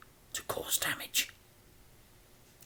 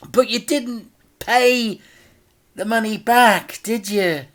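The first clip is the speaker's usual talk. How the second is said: higher sing-song taunt; mock-sweet villain; 12 semitones higher, 5 dB louder than usual. A narrator's reading. Crime boss taunts Dad about the unpaid debt. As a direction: lay the trap gently.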